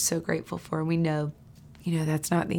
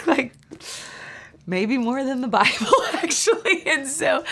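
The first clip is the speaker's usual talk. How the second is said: high, laughing aside; laughing at her own exaggeration; 7 semitones higher than usual. Real, not acted. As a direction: giggle through the admission.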